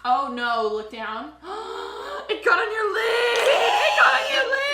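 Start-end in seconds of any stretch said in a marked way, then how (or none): 1.4-3.4 high, loud panicked cry; panicked shriek. Real, not acted. Eyeliner smudge panic. 3.4-4.7 higher, louder, slower wailing cry; panicked wail. Real, not acted. Eyeliner smudge panic, repeated.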